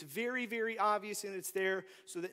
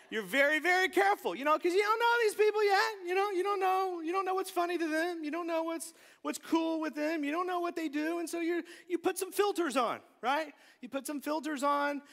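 higher and louder, driving the point home; preaching at full force; 8 semitones higher, 4 dB louder than usual. Acted. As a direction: preach it fast and urgent. Preacher building intensity.

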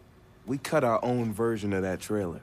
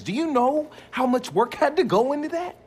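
amazed excitement; 12 semitones higher, 4 dB louder than usual. Real, not acted. Excited about the car's AI.

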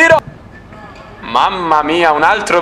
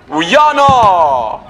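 excited shout. Real, not acted. Shot on goal.

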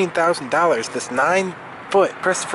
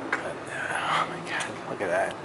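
awed whisper; 7 semitones lower, 9 dB quieter than usual. Real, not acted. Amazed at something.

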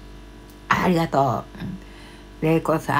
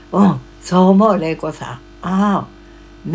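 ordinary delivery

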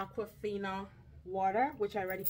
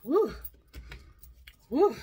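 excited woo; 6 semitones higher, 7 dB louder than usual. Real, not acted. Reacting loudly after a pause.

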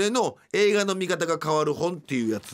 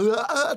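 mock crying wail; 9 semitones higher, 4 dB louder, slower than usual. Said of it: "泣きながら".

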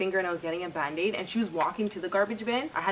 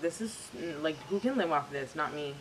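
dismayed mutter; 3 semitones lower, 7 dB quieter than usual. Retold or imitated. Quoting self reading paycheck.

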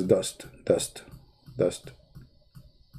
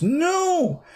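mock shout. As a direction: cut off the question with a big shouted no. Mock answer to his own question.

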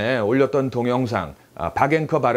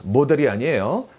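ordinary delivery

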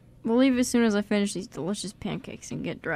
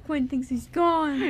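slow, high, hammed-up anguished outcry; mock dramatic cry; 5 semitones higher, much slower than usual. Cast member pitching a dark twist, mock dramatic. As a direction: sell the twist like a melodrama.

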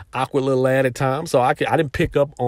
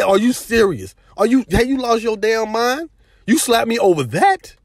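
indignant retort; 7 semitones higher than usual. Retold or imitated. Confronting the girl who slapped him.

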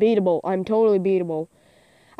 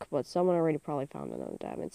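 ordinary talk

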